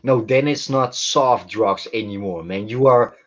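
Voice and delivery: ordinary delivery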